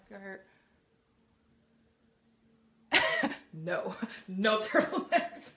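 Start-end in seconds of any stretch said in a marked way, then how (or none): 2.9-5.6 laughing through the words, slowed; real laughter while answering. Real, not acted. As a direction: giggle it out, amused. Laughing reply to a viewer comment.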